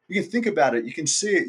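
usual speaking voice

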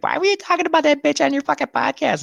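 very high, put-on complaining voice; nagging wife impression. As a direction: imitate a wife complaining in falsetto. Imagining wife's complaint.

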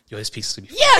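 put-on high, hyped-up kid; bubbling with anticipation; excited.

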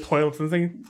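ordinary talk, nothing marked

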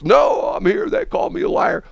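very high-pitched mock outburst; retold yelling. Customer refusing his family's plea, mock yelling. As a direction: imitate a customer refusing, worked up and shrieky. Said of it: "this guy is yelling and screaming".

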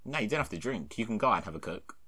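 usual speaking voice

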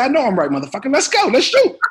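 rising to a high, loud rallying shout; Sam Jackson. Retold or imitated. Star rallying the rookie to start shooting, forceful. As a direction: imitate a star firing up a rookie. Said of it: "He said".

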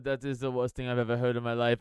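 low, robotic imitation; matter-of-fact, drained of emotion; Werner Herzog. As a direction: say it evenly, like a robot. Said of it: "that clip of him going".

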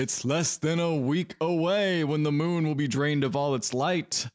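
hammed-up dark sorcerer reciting his plan; villainous uncle. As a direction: intone a hammy prophecy, ending in a staged evil laugh. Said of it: "cut to the uncle who's like".

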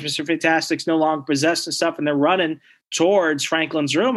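ordinary talk, nothing marked

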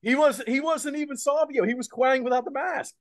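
high-pitched, excited protest; excited insistence. Real, not acted. Co-host revealing who the returning wrestler really was, excited and high. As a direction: insist on it, voice jumping way up.

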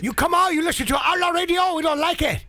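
mock Chinese accent. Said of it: "That was my Chinese accent".

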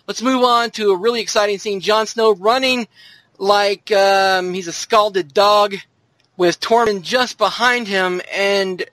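ordinary delivery

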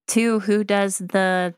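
ordinary talk

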